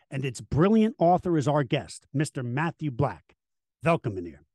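big multilingual ring announcer intro; lucha libre announcer. Acted. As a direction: announce the guest like a wrestling MC. Host announces guest in many languages.

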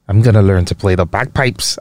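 mock Scottish accent. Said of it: "I don't know what accent that was".

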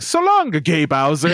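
very high mock-Italian catchphrase; Mario with venom. Shown as a demonstration. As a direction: spit it high with exaggerated venom. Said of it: "how much venom he puts".

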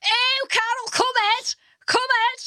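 high, loud delighted fan calling out; excited woman. Retold or imitated. Woman at a table calling Carol over, high and excited. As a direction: call her over, thrilled and high. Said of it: "this woman goes".

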